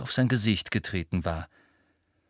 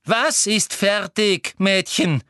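higher, sugary-eager Umbridge; 10 semitones higher, 4 dB louder, slower than usual. A narrator's reading. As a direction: voice the prying Umbridge. Voices Umbridge. (Umbridge mit hoher, neugieriger Stimme)

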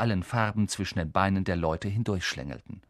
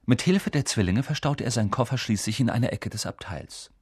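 ordinary delivery

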